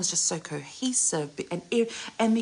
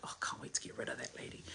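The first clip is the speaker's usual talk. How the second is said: fast hushed whisper, much softer; 5 semitones lower, 13 dB quieter, faster than usual. Retold or imitated. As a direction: mutter it under your breath, quick. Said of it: "she might be like".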